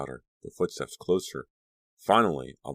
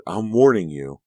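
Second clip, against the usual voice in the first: angry; 4 semitones higher, 7 dB louder than usual. Acted. Dee threatens after shouting.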